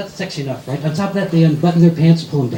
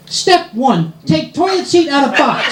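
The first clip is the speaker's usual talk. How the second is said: mock reading shout; 6 semitones higher, slower than usual. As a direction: shout the sign's words. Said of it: "You know what it says".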